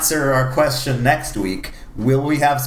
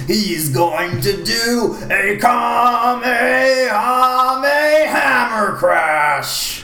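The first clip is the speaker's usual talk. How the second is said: excited shout; 8 semitones higher, slower than usual. Pretend. Hyping a monster truck anime.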